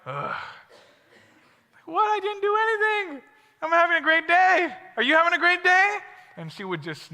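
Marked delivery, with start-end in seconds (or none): 0.1-0.3 a low, soft mock groan of disgust; annoyed groan. Classmate annoyed at positive teller. 1.8-6.0 much higher, chirpy mock innocent voice; over-cheerful self. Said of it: "she was like".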